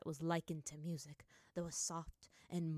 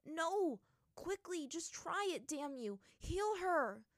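shouting; 10 semitones higher, slower than usual. A narrator's reading. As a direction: yell at her to hurry. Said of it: "he shouted at the old waterbender".

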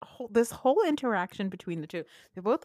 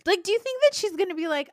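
high, loud and fast incredulity; incredulous; 12 semitones higher, 8 dB louder, faster than usual. Real, not acted. Host ranting at Sheree's logic, fast and high. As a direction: rattle it off in loud disbelief. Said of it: "exasperated at Sheree".

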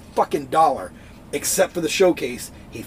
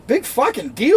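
sarcastic scoff; 9 semitones higher, 4 dB louder than usual. Retold or imitated. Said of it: "And you're like".

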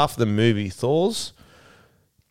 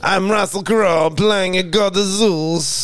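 put-on higher, slow, hammy Italian-accented Zeus; Russell Crowe Italian accent; 9 semitones higher, slower than usual. Friend asked for impression of Crowe's Zeus, Italian accent. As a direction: boom like a cheesy Italian god. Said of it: "Give us an impression".

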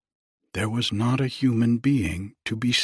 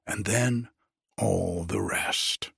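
usual speaking voice

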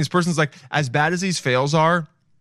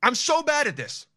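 higher-pitched frustrated self-scolding; mock frustrated rant; 13 semitones higher than usual. Picturing himself on the baking show, getting frustrated. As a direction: whine at yourself for signing up. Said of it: "I would just get mad".

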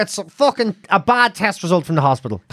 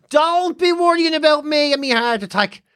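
high, put-on brave reassurance; mock martyr mum; 6 semitones higher than usual. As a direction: play down a disaster as the long-suffering mother. Mother playing down her stroke.

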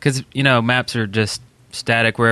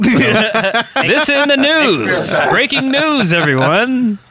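high, slow, hammed-up breaking news voice; news announcer; 9 semitones higher, much slower than usual. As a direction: announce it like a TV newsreader.

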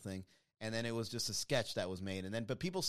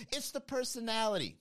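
mock sincere; 9 semitones higher than usual. Sarcastic echo of the comment.

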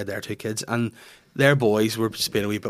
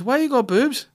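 put-on high-pitched little girl asking; piping kid impression; child's voice; 14 semitones higher, 4 dB louder than usual. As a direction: imitate a child's blunt, high question. Voices daughter asking blunt question.

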